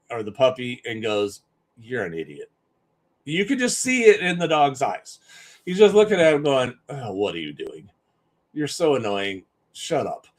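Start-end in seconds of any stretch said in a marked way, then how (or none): 1.8-2.5 put-on low, soft, unimpressed old dog; the old dog sighing at the puppy, low and quiet; weary old dog. Old dog voiced looking at puppy. 6.9-10.3 put-on low, soft, fed-up old dog; an old dog grumbling at a hyper puppy; annoyed old dog. Old dog voiced scolding the puppy.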